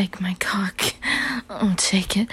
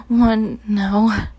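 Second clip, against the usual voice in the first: louder voiced command ending the countdown; commanding call; 7 dB louder than usual. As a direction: hit the final count hard. Climax of the countdown.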